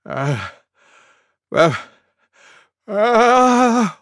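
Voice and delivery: gasping pre-sneeze wind-up; building sneeze. A narrator's reading. Elephant about to sneeze as everyone waits, building gasps. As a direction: gasp in bursts, each one bigger. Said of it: "went Elephant".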